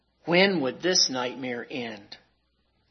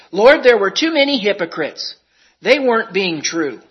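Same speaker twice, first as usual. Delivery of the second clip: pleading excuse; 4 semitones higher, 8 dB louder than usual. Acted. Sinner arguing with Jesus.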